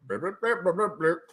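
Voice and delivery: high-pitched nonsense blurps; silly blurping noises. Pretend. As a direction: burble playfully in a high voice. Fills a pause with silly noises.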